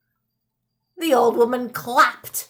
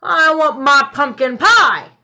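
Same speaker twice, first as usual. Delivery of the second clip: put-on demanding old man; 2 semitones higher, 8 dB louder than usual. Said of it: "croaked the old man".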